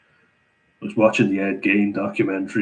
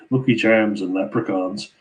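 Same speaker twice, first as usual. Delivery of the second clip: usual speaking voice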